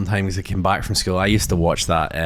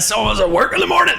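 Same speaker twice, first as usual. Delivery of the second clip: high mock scolding parent; hammed-up high nagging parent; 3 dB louder than usual. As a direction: pitch it up like a cross mum.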